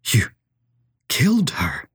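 hushed, halting whisper of disbelief; stammering. A narrator's reading. Professor grasps that she killed someone, stammering in a whisper. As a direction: break off after the first word, then whisper the rest. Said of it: "O'Malley stammered".

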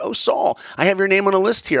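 a hint of a chirpy official; Disney man. Disney man voiced in retold call.

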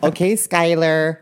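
hammed-up yuppie mother; yuppie mom. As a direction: coo at the kids like a rich mom. Mocking yuppie parent.

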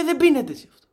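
high, loud mock scolding; hammed-up parental outrage; imitated appalled father. Father scolds how he drinks.

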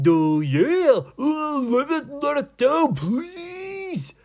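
high, loud wail of a squashed pirate; hammed-up cry for release; pained pleading. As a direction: plead in mock agony, stretching the last word. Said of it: "they sounded like they were in pain".

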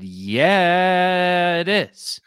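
loud, high, long-stretched "yeah"; indignant retort. Retold or imitated. Answering a guy who doubts New Hampshire is a state, loud indignant retort.

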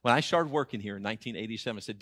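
ordinary delivery

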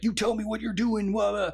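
mildly hammed-up tough-guy demand; mock demand. Retelling Indy threatening the guy he caught, mock demand.

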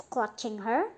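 ordinary delivery, nothing marked

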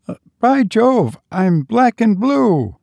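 high, strained cry of mock pain; a forced laugh over a bruised arm; pained exclamation. A narrator's reading. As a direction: yelp it out, half laughing.

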